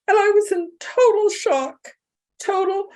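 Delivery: fighting back tears while telling it; choked up. Real, not acted. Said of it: "I'm an emotional person".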